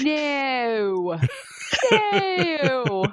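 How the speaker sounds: high laughing drawn-out no; mock Yvonne no, cracking up; Yvonne saying no. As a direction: stretch the no up high while laughing. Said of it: "his impression of Yvonne saying no".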